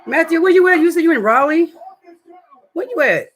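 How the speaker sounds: loud call across the room, high and strong. Real, not acted. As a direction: shout for Matthew to answer. Calling out to Matthew.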